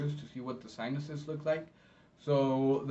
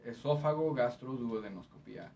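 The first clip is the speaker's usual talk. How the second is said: Spanish ending in -pía; Spanish accent. Shown as a demonstration. Interpreter contrasting Spanish with English, in a Spanish accent. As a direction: say the long term in Spanish. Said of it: "with a Spanish accent".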